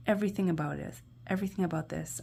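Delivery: ordinary talk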